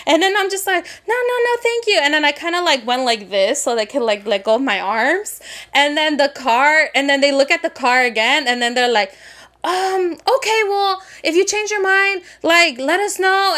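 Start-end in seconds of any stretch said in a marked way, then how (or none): 1.1-2.0 much higher, sing-out polite refusal; acted high-voiced brush-off. Acted refusal while being pulled. 9.7-13.5 put-on high-pitched girl voice backing off; acted higher, noncommittal goodbye; imitating the girls.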